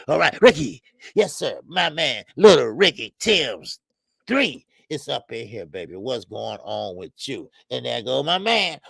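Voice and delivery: normal talking voice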